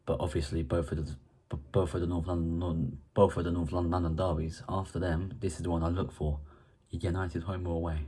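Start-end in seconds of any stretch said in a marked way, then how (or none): none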